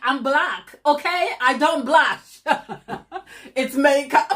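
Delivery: real laughter answering a viewer; laughing. Real, not acted. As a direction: laugh while you deny it. Laughing reply to blushing joke.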